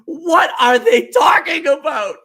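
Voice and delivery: high, slow, loud mock yell; a laughing, drawn-out shout. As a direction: belt it out in amused confusion. Retelling own confusion, laughing.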